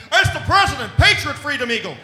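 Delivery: high, very loud military announcement; hammed-up American cop shout; mock American security guy. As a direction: bark it out like a gung-ho agent. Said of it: "they're all like".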